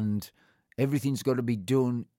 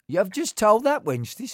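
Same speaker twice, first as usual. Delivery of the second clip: higher, louder mock scolding of a colleague; hammed-up reprimand on a patient's behalf; retold telling-off; 10 semitones higher, 6 dB louder than usual. Doctor to a colleague who upset a patient, a retold telling-off.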